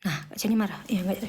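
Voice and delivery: ordinary talk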